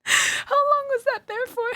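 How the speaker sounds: stunned giggly question; incredulous. Real, not acted. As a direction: stretch it out in shocked disbelief. Disbelief at long tracking loss.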